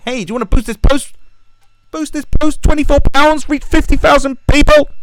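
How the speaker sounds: high-pitched, shouted fast sales harassment; pestering sales pitch. Acted. Facebook nagging page owners to pay for boosts, pestering shouted pitch. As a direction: badger them with a loud, squawking mock pitch. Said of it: "they fucking harass you".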